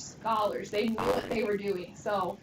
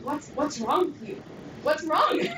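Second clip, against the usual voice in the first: confused alarm; 5 semitones higher, slower than usual. Retold or imitated. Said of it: "he's like".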